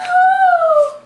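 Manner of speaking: very high, loud mock-crying wail; hammed-up bawl on one long high note. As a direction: let out a long pretend boo-hoo howl. Princess bawling over lost ball.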